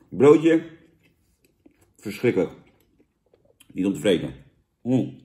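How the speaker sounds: chewing while talking; muffled by food; mouth full of burger. Real, not acted. Seen 1: Bites at -2, talks chewing, bites again at 4.1.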